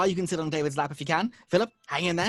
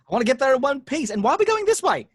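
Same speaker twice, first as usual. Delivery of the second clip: higher, louder, rising alarm; exasperated; 7 semitones higher, 4 dB louder than usual. Acted. Sean yelling at Ed to drive carefully, exasperated. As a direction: scold the reckless driver, losing patience.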